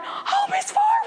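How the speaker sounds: high, slow mock alarm; alarmed staff. Office staff fussing over the hurt teacher, high alarm. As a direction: coo it in a high, worried voice. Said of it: "they're like".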